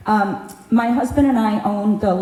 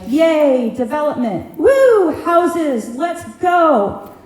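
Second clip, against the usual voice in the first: high, loud fake cheer for developers; mock a giddy realtor rooting for houses; 7 semitones higher, 4 dB louder, much slower than usual. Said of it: "be like".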